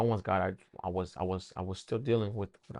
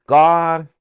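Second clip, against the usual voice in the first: soaring, much louder and higher "God"; preacher shout; 9 semitones higher, 15 dB louder than usual. Retold or imitated. Preacher proclaiming God to the young man, shouting. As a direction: belt out the name like a preacher. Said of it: "he said".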